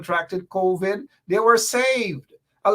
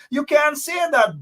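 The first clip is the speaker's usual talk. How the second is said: shouted retort; 7 semitones higher than usual. Real, not acted. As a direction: slam the rebuttal at him. Calling out opponent Bharat.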